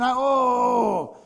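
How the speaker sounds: a slow, strained cry of frustration; exasperated groan. Acted. Preacher acting out his anger at a fellow churchgoer, a frustrated cry. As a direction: let it burst out of you as an aggrieved oh. Voicing irritation at a church member.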